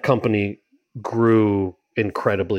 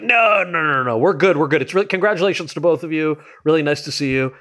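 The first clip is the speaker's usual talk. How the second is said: higher, hurried, waving it off nervously; mock flustered deflecting; 6 semitones higher than usual. Waving off the president-elect, mock nervous and flustered. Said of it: "And I was like".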